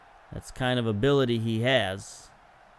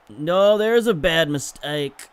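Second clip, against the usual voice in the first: louder, slower cry at the blunder; dismayed outburst; 3 semitones higher, 7 dB louder, slower than usual. Real, not acted. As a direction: blurt out the mistake in dismay. Defensive error.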